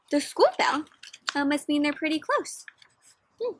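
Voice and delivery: lively storybook read-aloud for a class; reading to children. Acted. Teacher reading a picture book aloud to her class, lively. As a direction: paint the story for the kids.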